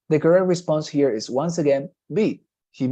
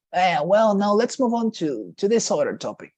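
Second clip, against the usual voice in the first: bored, dismissive; 6 semitones higher than usual. Acted. Professor moving on to a topic he finds boring, dismissive. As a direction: act like a professor who dislikes the topic. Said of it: "he dislikes or that he considers this topic to be boring".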